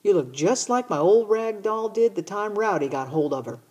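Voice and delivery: a hint of a giggling little sister; little sister teasing. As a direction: tease your big brother like a giggly little sister. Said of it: "She giggled and said".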